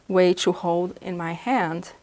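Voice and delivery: ordinary talk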